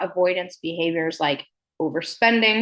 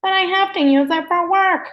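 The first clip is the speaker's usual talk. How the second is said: whiny excuse; 11 semitones higher, 4 dB louder than usual. Retold or imitated. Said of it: "I used to say that".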